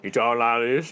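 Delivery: mushy, mumbled words; marbles in mouth. Shown as a demonstration. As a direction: speak as if marbles fill the mouth. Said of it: "he had marbles in his mouth".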